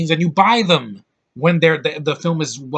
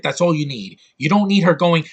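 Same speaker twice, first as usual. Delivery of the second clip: ordinary talk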